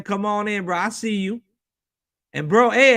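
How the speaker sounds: ordinary delivery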